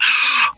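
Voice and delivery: imitated gasp of surprise; sharp mock gasp, as others react; amazed gasp. Retelling grateful followers' reactions.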